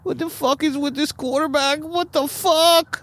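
put-on high, slow, exasperated old man rant; Al Davis impression. Host mimicking Al Davis cursing his quarterback. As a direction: whine it out like a fed-up old owner. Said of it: "That's how Al Davis talks".

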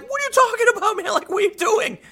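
very high-pitched, alarmed retort; hammed-up panicked protest; mock panic. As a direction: squeal it in comic bewilderment. Startled residents answer armed cops.

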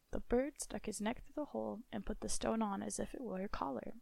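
ordinary delivery, nothing marked

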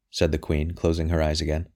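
plain reading voice

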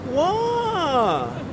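put-on high, drawn-out Mandarin-accented cry; imitated Mandarin accent. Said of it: "she would say with the accent".

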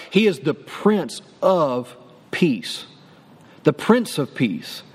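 low, slow Prince of Peace; slow proclamation. Shown as a demonstration. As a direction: say it slowly and low, like a title. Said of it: "slowed proclamation".

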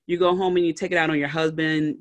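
ordinary talk

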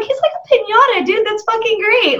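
high, delighted movie reaction; gleefully retelling his reaction; excited. Retold or imitated. Friend retelling a gory kill to his cohost, excited high voice.

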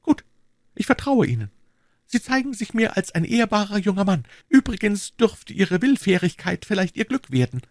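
put-on young woman's voice, pitched very high; high-voiced lady confiding in a gallant; Madame Bonacieux. A narrator's reading.

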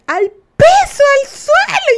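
high, loud warning yell; coworkers yelling. Retold or imitated. Coworkers shouting for everyone to hit the floor, high urgent yell. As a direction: shout it like bullets are flying. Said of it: "mis compañeras que decían".

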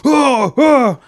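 high, loud roar of a yell; re-enacted big bear yells; imitated yelling. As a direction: roar to scare off the dogs. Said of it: "I'm, like, yelling".

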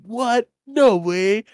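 high, loud mock amazement; astonished. Boys hearing of the stolen cigarette, acted astonishment.

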